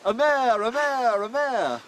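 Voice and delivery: high, loud harvest cry; demo shout. Shown as a demonstration. Professor demonstrates the winner's cry, shouting high and loud. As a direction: cry it out proudly across the field. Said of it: "And you shout".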